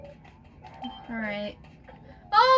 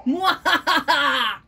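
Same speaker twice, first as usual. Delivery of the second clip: slow, drawn-out villain cackle; slow evil laugh; much slower than usual. Acted.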